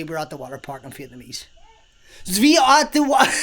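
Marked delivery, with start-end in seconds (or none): 2.4-3.3 high, loud mock German accent; camp German accent. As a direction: attempt Vietnamese, land on camp German, breaking off.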